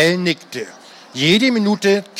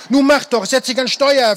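pleading; 7 semitones higher than usual. A narrator's reading. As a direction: beg him to take the controls. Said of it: "flehte Mike".

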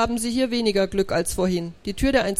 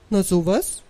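surprised; 2 semitones lower, 3 dB louder than usual. A narrator's reading. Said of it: "sagt erstaunt".

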